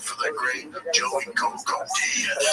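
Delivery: slow booming announcer voice; announcer impression. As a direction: announce it like a ring MC. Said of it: "Hey, that was good. The Coco part at the end".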